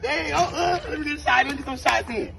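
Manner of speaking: high, eager plea for a turn; excited play-along. Pretend. Player asking to shoot some shots, high and excited.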